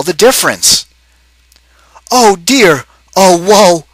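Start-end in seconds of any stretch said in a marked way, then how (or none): 2.1-3.9 higher, louder hammed-up lament; moaning. Husband bemoaning his doubled wife, hammed-up high moan. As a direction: wail it like a stage mourner. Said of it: "Mister O'Grady moaned".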